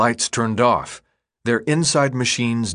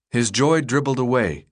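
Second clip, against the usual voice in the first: ordinary delivery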